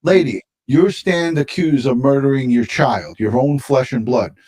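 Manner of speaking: ordinary talk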